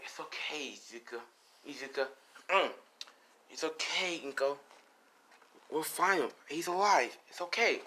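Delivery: soft, soothing reassurance; comforting grandma. Acted. Grandma to crying Inko, comforting. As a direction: hush and console gently.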